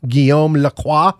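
French pronunciation of Guillaume Lacroix; name read in a French way. Real, not acted. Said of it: "Is that the most French name you've ever heard?".

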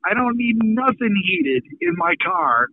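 usual speaking voice

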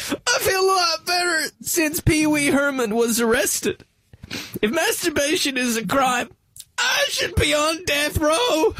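high strangled shouting comedian; Bobcat Goldthwait voice. Retold or imitated. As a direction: screech it out, cracking. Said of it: "The guy who talks really strange".

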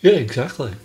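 mid-yawn. Real, not acted. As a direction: talk through a yawn. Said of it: "Sorry, I was yawning".

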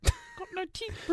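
whale voice. Retold or imitated. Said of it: "Beach Des".

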